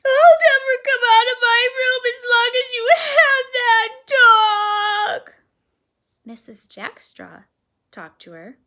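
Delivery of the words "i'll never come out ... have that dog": very high, loud, crying protest; bawling. A narrator's reading. Girl locked in her room bawling at the family, sobbing. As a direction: bawl it through sobs. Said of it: "snuffle and bawl".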